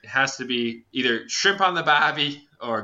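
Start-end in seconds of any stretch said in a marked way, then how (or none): 1.3-2.5 slow, stock Aussie catchphrase; Australian accent. Shown as a demonstration. Comic explains how to get into an accent, Australian. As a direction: ease into Australian with a drawn-out catchphrase. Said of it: "dive into an Australian accent".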